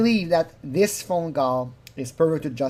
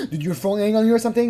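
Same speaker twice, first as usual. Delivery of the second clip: quick, faintly blaming question; mock accusing; 7 semitones higher, faster than usual. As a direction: toss the blame onto her, fast. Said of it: "he's really reblaming her".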